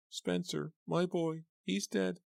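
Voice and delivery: high, tearful lament; crying. A narrator's reading. Mother mourning her son, crying. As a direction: weep over her lost boy. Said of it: "starting to cry".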